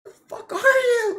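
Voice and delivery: high, loud squealing disbelief; shocked outrage. Retold or imitated. Retold reaction to the creepy boss, high shocked shriek. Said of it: "I was like".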